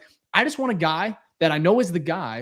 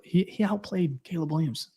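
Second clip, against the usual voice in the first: mock whisper; 2 semitones higher, 5 dB quieter than usual. Real, not acted. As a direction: say it softer, like a reluctant admission. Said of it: "I'm whispering that".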